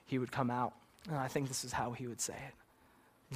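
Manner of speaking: normal talking voice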